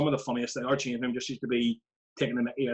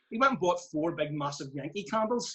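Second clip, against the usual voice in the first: ordinary talk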